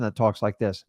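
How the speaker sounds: dull, low monotone voice. Shown as a demonstration. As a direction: drone like a boring salesman. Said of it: "do not be that monotone person".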